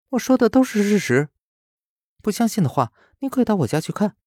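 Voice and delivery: put-on high, indignant girlish insistence; insistent girl. A narrator's reading. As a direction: stand your ground, a bit upset. Lin Wei insists.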